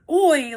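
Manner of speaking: "oi" said as one drawn sound, like "oy"; mock pained "oi". Shown as a demonstration. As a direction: act out a mild hurt "oi", rhyming with toy. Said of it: "like I hurt my toy".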